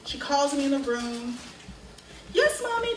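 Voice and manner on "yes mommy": put-on high, obedient child voice; eager little girl. Child called in by mommy, imitated eager answer. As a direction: answer like a small child wanting to please.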